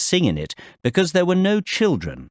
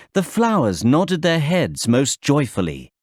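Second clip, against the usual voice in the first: ordinary talk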